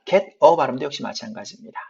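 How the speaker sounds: normal talking voice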